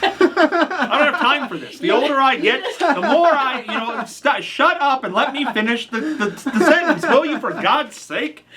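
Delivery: high, laughing complaint; exasperated but half laughing; mock exasperation. Interrupted host scolds co-host, laughing.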